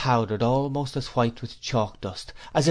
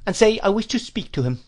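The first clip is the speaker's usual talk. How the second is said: put-on higher, brisk old lady giving orders; commanding old aunt; 9 semitones higher, 4 dB louder than usual. A narrator's reading. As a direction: order the servant about like a mistress of the house. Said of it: "said my aunt".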